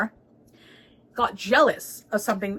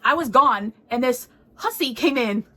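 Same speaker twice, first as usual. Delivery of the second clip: indignant quote; 4 semitones higher, 5 dB louder, slower than usual. Retold or imitated. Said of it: "the Emperor was like".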